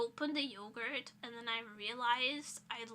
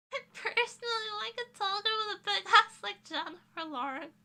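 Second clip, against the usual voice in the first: higher and louder put-on voice; mock character quote; 9 semitones higher, 7 dB louder than usual. To chat, mocking a line about tall girls, hammed-up voice. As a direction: ham up a goofy quoted line.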